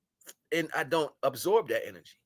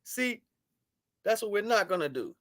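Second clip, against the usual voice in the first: higher, louder sneering mimicry; mock the doubters' smug words; mocking quote; 7 semitones higher, 5 dB louder than usual. Imitating viewers who laugh at him, mocking. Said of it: "you sit back and you laugh and you say".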